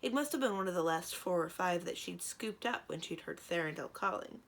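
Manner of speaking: plain reading voice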